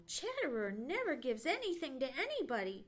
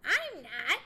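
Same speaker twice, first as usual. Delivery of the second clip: loud, sharp squirrel retort; 3 semitones higher, 10 dB louder than usual. Acted. Red squirrel answering Johnny, sharp retort. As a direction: fire back at once. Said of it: "retorted Chatterer".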